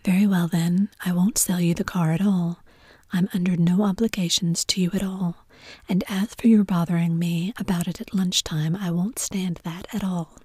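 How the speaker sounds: ordinary delivery, nothing marked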